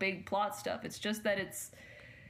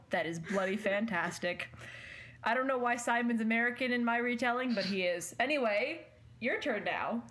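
ordinary delivery

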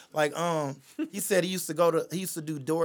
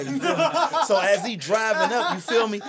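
laughing; 6 semitones higher, 8 dB louder than usual. Real, not acted. Laughing while telling story.

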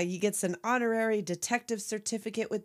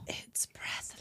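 whispered dramatic reveal; hushed whisper; 12 dB quieter, slower than usual. Acted. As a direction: whisper it like a secret.

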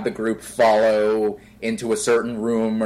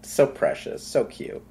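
whispered, softer "so precious, so cute"; whispered cooing; 2 semitones lower, 5 dB quieter than usual. Real, not acted. Cooing at the co-host's Nemo line, whispered. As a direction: coo it in a whisper. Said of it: "doting on the Nemo quote".

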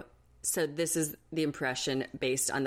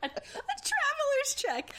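much higher, slow, laughing; laughing, much higher mock disbelief; slower than usual. Real, not acted. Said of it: "is it nineteen eighty-seven?".

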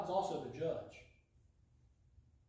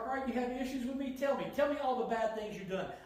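higher, fast, louder taunting; sarcastic God; 6 semitones higher, 4 dB louder than usual. Acted. God taunting people with issues against him, sarcastic. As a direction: needle them to confess. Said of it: "this is slightly sarcastic God".

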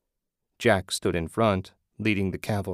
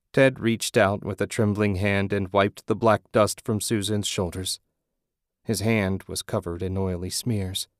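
ordinary delivery, nothing marked

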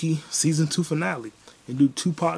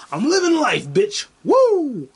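loud, high, gleeful yell; exuberant whoop; 10 semitones higher, 8 dB louder, slower than usual. Real, not acted. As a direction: celebrate it loudly, ending on a big whoop. Celebrating his spending despite no job.

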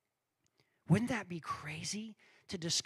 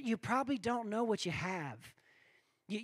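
ordinary talk, nothing marked

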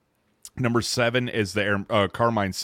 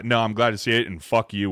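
ordinary talk

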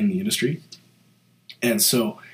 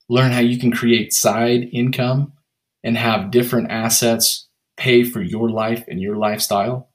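ad read; 6 dB louder than usual. Acted. Sponsor and sign-off read.

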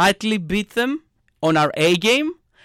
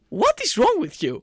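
high-pitched exasperated question; exasperated demand; 10 semitones higher than usual. Acted. Fan scolding their team, exasperated. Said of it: "And I'm like".

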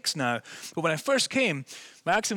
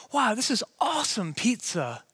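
higher, wowed exclamation; acted enthusiasm, pitched up; mock excited gushing; 8 semitones higher than usual. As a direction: gush like an impressed diner. Mimics casual gushing over pizza.

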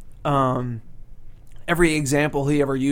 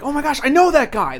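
high, louder retold exclamation; excited recognition; 11 semitones higher, 6 dB louder than usual. Teacher's student retelling seeing him in a commercial, excited recognition. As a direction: blurt it out like spotting someone you know.

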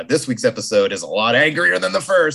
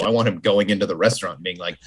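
ordinary talk

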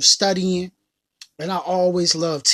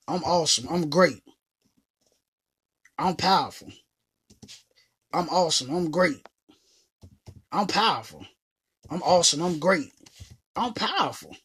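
repeated affirmations; 4 semitones lower, 4 dB quieter than usual. Shown as a demonstration. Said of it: "say it to yourself every day".

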